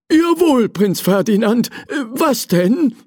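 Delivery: high-pitched attentive manservant; obliging old servant. Acted. Servant answering the prince's request, high and obliging. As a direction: answer the young master eagerly, pitched high. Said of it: "erwiderte Hugo".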